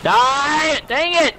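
high-pitched gamer frustration; frustrated yelling. Real, not acted. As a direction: cry out at the missed shot. Frustrated at missing kills.

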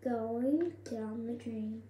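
speaking through wet face mask; talking with sheet mask on. Real, not acted. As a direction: lips moving under mask sheet.

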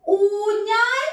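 put-on ഊണ് called out high and clear for children to repeat; teacher modeling a word. Shown as a demonstration. Teacher to class, modeling the word for the children to repeat. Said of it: "ഒന്ന് പറഞ്ഞേ".

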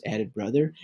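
plain reading voice

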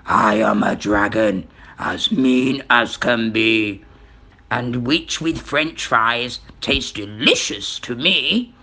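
low, menacing dragon voice; deep story-villain dragon; mean dragon. A narrator's reading.

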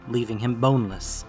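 ordinary delivery

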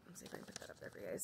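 breathy whisper, almost no voice; whispered aside. Real, not acted. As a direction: whisper it to yourself while showing the label. Aside while holding up yarn.

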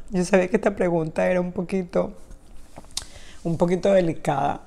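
voz baja y quebrada por el llanto; crying. Real, not acted. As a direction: hablar entre lágrimas, conteniéndose. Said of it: "perdón que estoy llorando". (llorando suavemente)

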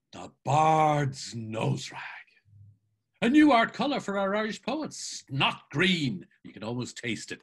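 lightly hammed-up mocking declamation; mock-grand Mulligan. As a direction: announce it with teasing pomp. Mulligan declaims over the handkerchief.

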